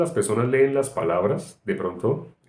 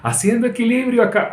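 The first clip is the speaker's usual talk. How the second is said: furious shout; 6 dB louder than usual. Retold or imitated. Father scolding his son off the bricks, furious shout. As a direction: yell it down at the child like a frightened father. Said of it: "así, así".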